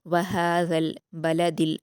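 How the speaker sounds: slow, careful Quranic reading; slow recited verse; tajweed recitation. Shown as a demonstration. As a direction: draw the verse out slowly. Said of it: "नरमी से पढ़ेंगे".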